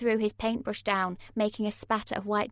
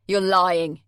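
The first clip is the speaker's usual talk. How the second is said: angry; 10 dB louder than usual. A narrator's reading. Jay furious.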